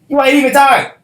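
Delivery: loud, high yell of disbelief; shouted callout. Real, not acted. To listeners who only think they're tired, a loud high shout. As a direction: call them out at full volume.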